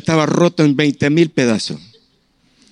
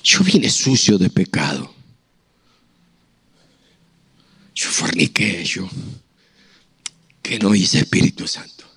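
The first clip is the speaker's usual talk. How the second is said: sincere confession; 7 semitones lower, 3 dB quieter than usual. Retold or imitated. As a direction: confess quietly, falling to a hush. Confessing to the Holy Spirit.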